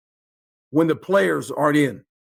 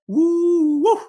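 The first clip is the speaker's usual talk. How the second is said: mock hooting; 10 semitones higher than usual. Mocking the New York frenzy.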